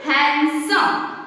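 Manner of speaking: "handsome" as han-sum; "handsome" in two parts, no D between N and S; silent D. Shown as a demonstration. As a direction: say "handsome" slowly, leaving out the D. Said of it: "We pronounced".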